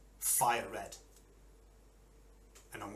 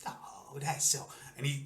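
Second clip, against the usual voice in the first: ordinary delivery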